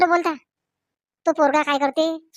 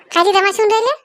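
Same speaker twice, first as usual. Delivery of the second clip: higher, louder indignant question; 4 semitones higher, 4 dB louder than usual. Acted. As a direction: throw the question back. Different character echoes the question. (चिडून उंच आवाजात प्रश्न)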